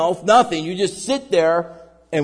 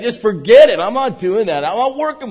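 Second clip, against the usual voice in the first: mock giving up; 5 semitones higher, 6 dB louder than usual. Voicing people giving up.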